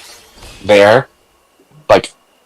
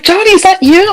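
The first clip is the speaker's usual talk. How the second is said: put-on much higher, squealing girl's gasp; girly surprised voice; 3 dB louder than usual. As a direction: play a surprised girl at a rave spotting her old babysitter. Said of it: "She's like".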